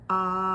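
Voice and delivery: long sustained loud ahh; held ah sound. Shown as a demonstration. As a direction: hold a steady ah for breath support. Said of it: "we do an ah sound and we hold".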